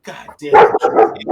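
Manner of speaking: slow, loud groaning curse; exasperated. Retold or imitated. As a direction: drag it out in exasperation. Boss exasperated, retold.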